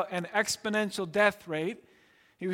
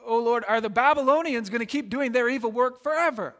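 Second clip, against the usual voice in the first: stammering, higher and louder protest to God; worked-up prophet; 4 semitones higher, 5 dB louder than usual. Acted. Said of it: "so worked up that he says".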